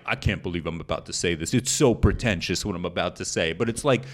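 lightly put-on Boston tough-guy accent; a hint of a Massachusetts guy; mild Boston accent, embarrassed at sounding fancy. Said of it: "abysmal Boston accents".

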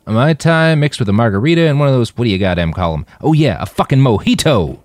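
put-on loud and high, boisterous drunk ordering; rowdy drink order. Roland hollering his drink order to the bartender, loud and excited. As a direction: bellow the order at the bartender, giddy.

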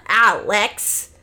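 loud, hammed-up sarcasm; dragged-out mocking finish; mocking disbelief. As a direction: punch it out loudly, amused and incredulous. Acting out Alex's sarcastic mockery.

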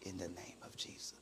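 barely audible, voiceless whisper; low whisper. Retold or imitated. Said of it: "then I whispered real low".

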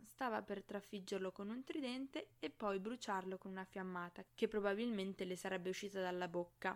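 usual speaking voice

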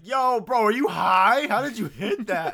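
high, loud jeering bro voice; mock the kid who crashed, loud and high; mocking dude. Stranger mocking the driver after the crash, loud high jeer.

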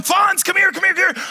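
high mock yell at the fawns; a hammed-up call, running at deer; preacher playing a man chasing fawns, loud mock call.

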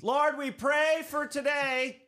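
very high, drawn-out holler; mock shouted prayer. Host mock-opening a Heartland service, shouted prayer. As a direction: belt out a hammed-up prayer. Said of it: "he looked up and shouted".

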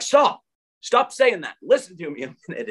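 high, sharp commanding cry of stop; urgent retold shout. Shaking a panicking woman.

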